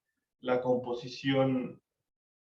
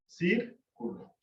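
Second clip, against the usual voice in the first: "Círculo" slowly split, CÍR set apart; stress on first syllable; 3 semitones higher, 5 dB quieter, much slower than usual. Shown as a demonstration. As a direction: lift CÍR in "Círculo", then a softer cu-lo. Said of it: "y se acentúa". ("Círculo" lento, con CÍR separado y acentuado)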